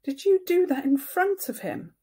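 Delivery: sharp, high, stern question; a mother turning stern; serious and stern. Acted. Mother confronting her small son, stern and sharp. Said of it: "getting serious".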